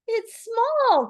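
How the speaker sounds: very high, loud squeal of discovery; thrilled little creature; crying out. A narrator's reading. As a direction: cry it out in excitement. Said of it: "cried Piglet".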